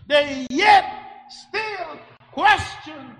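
emphatic shout. Real, not acted. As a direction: drag out still question in disbelief. Preacher stressing Israel's doubt.

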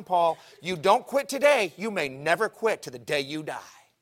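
loud, high-pitched preacher's warning; forceful warning. Retold or imitated. God warning Paul to quit today, preached loudly. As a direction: drive the warning home loudly.

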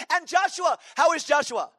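very high, loud, eager question; Brother Vic. Retold or imitated. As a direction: imitate an effervescent pastor shouting his question. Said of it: "he talked like that".

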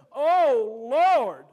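loud, rising preacher's cry of "Oh, Lord"; fervent cry to the Lord. Retold or imitated. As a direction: call out to God with fervour. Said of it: "He says".

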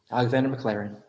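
normal talking voice

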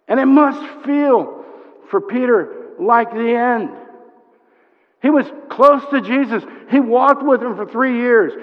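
high, loud, insistent sermon voice; impassioned preaching. Real, not acted. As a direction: press every phrase on the congregation. Preaches at full force.

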